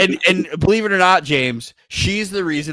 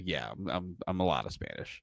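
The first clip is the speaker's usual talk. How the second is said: deadpan reply; 10 semitones lower, 14 dB quieter than usual. Retold or imitated. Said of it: "I'm like".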